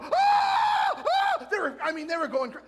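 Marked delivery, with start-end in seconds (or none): 0.0-1.5 two very high, loud screams; mock celebration scream. Fans running after the miracle play, screaming wildly. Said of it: "They were running, going".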